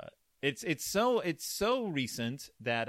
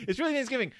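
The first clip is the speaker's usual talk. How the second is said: high, louder mock-earnest claim; mock insistence; 11 semitones higher, 5 dB louder, much faster than usual. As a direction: wink while insisting. Joking wink about the date.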